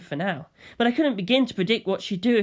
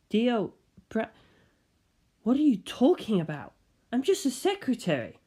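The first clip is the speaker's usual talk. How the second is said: higher, softer fake innocence; feigned confusion; 5 semitones higher, 3 dB quieter than usual. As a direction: play dumb and nervous. Kira feigning, flustered.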